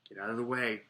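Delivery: ordinary delivery, nothing marked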